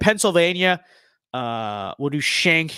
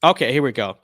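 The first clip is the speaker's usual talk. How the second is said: ordinary talk, nothing marked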